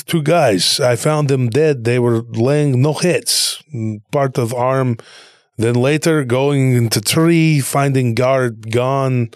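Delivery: slow broken English in a mock Russian accent; put-on Russian accent, words doled out slowly. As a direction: imitate a Russian soldier reporting in halting phrases. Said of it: "with a Russian accent".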